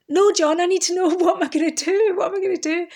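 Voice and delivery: high, loud, half-laughing mock panic; panicked plea. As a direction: beg John for an answer, hamming up the fear. Pleading with friend about future.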